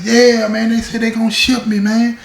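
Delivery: high called-out yell from a cell; yelling from the hole. Retold or imitated.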